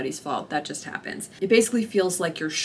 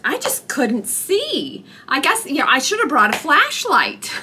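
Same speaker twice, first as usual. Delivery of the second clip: mocking the nurse; 7 semitones higher, 6 dB louder than usual. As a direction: drag it out like a clueless excuse. Nurse's excuse afterwards.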